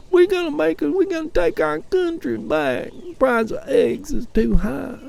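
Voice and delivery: shrill-pitched parody of campaign talk; mocking Trump supporter. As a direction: lampoon a gullible MAGA fan.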